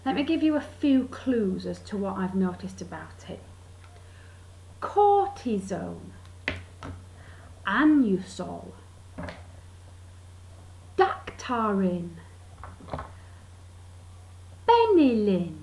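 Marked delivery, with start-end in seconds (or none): 4.8-15.6 slow, drawn-out product names, long pauses between; weighty three syllables. Retold or imitated. Listing medicines like Jehovah, weighty and slow. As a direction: intone each name syllable by syllable. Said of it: "as if three syllables makes it more weighty".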